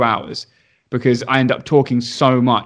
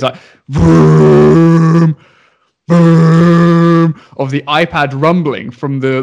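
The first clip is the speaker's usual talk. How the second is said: louder mock vibrating rumble; imitated phone buzz; 6 dB louder, much slower than usual. Teacher imitates the iPad vibrating with his call, loud buzzing. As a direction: hum the iPad buzzing on the table. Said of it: "all I hear is".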